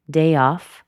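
slow "day off", falling on "off"; slight downturn on "off"; downward intonation. Shown as a demonstration. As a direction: let "off" drop a little. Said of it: "hear the downward intonation".

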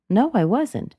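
ordinary talk, nothing marked

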